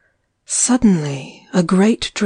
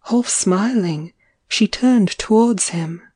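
usual speaking voice

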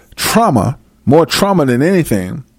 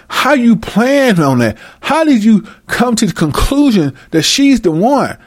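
usual speaking voice

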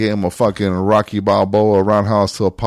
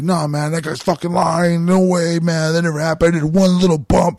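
put-on higher, whiny drunk protest; drunk denial; 5 semitones higher than usual. Drunk friend denying the coke in the back seat, whiny and high. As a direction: play the high friend swearing innocence.

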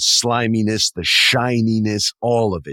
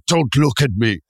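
a hint of a raised, hammed-up corpse; campy corpse voice; 5 semitones higher than usual. Half corpse begging Julia not to look, hammed up. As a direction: ham up the monster's plea. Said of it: "these types of voices".

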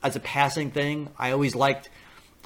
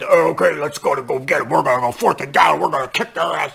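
cocky coach; 7 dB louder than usual. Retold or imitated. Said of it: "He's like".

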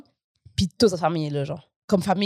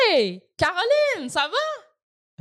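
imitating the uncle; 7 semitones higher, much slower than usual. Uncle mistakes her name, retold.

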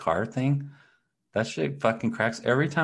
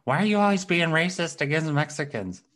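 high, mock-indignant quote; imitated complaint; 9 semitones higher, 3 dB louder than usual. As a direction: lightly act out an accusing question. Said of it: "then she's like".

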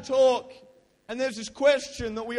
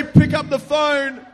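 put-on like a girl; 6 semitones higher, 10 dB louder, slower than usual. Shown as a demonstration. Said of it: "like a girl".

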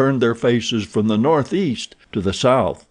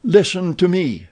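higher, urgent plea; pleading; 8 semitones higher than usual. A narrator's reading. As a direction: beg him to listen. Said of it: "he pleaded".

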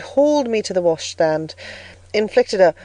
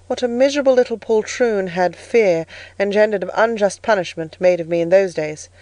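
plain reading voice

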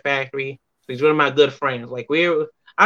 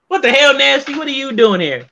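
higher, louder what the hell, with a laugh; mock shout; 10 semitones higher, 5 dB louder than usual. Guest imitates Billy Gunn's loud surprised greeting. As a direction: call it out in playful disbelief. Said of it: "Billy Gunn hit me with the".

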